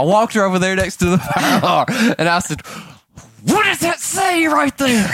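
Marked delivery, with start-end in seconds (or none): 3.4-5.1 high, slow, mock-outraged question; exasperated demand. Dad making daughter read the sign, exasperated. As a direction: imitate a dad making his point slowly.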